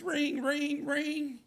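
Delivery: high sing-song ring ring like a phone. Retold or imitated. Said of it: "And then my phone rings".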